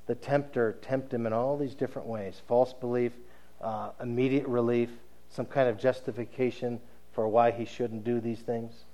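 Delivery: normal talking voice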